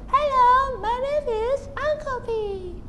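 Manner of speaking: very high squeaky tiny voice. Shown as a demonstration. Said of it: "Or just tiny voice".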